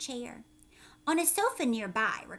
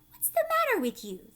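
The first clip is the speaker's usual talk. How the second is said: high, louder worried question; old grasshopper; 13 semitones higher, 6 dB louder than usual. Acted. Grasshopper asking frightened James, high louder worry. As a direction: squeak out his alarm. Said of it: "the old green grasshopper asked".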